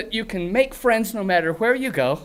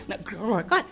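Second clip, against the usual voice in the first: ordinary talk, nothing marked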